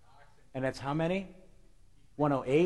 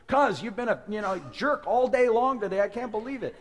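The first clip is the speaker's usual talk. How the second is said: higher, louder, fed-up prophet; exasperated Balaam; 8 semitones higher, 5 dB louder than usual. Acted. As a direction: snap back at the donkey. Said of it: "And Balaam says".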